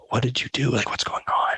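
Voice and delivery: hushed, voiceless questioning; whispering. Retold or imitated. Friend asking speaker about the fight, whispered. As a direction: whisper it urgently to a friend in trouble. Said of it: "whispers me".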